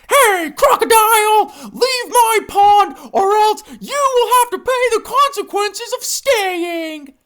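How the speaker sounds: very high, loud squeaky shout; bossy hippo shout. Acted. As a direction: shout at the intruder from up in a cartoon squeak. Hippo orders alligator to leave.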